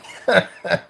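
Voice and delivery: a high bright laugh. Real, not acted. Recalling tricking his supervisor, a high laugh. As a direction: laugh out loud.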